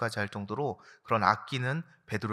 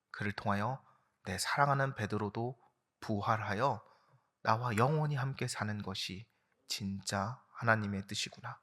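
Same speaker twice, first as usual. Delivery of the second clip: quiet realization; 4 semitones lower, 7 dB quieter than usual. Acted. Jesus realizing God's will, lightly voiced and hushed. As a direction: let it dawn gently.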